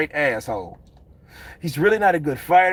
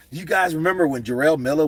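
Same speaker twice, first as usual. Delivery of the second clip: ordinary delivery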